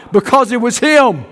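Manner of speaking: ordinary talk, nothing marked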